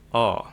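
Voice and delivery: O with the mouth kept in A position; wide-mouthed, open "O". Shown as a demonstration. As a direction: say "O" without closing up from A. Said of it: "tanpa mengubah posisi mulut".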